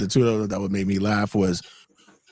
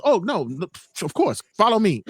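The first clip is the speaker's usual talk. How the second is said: mock helpful; 7 semitones higher than usual. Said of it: "And Owen goes".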